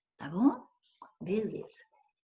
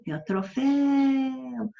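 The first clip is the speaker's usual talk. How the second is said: troféu slow, higher, the final féu held; stressed troféu; 6 semitones higher, slower than usual. Shown as a demonstration. Teacher showing the class the stressed last syllable, slow and high. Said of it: "ó, troféu".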